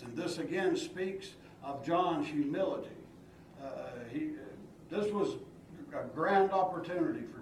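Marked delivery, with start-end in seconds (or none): none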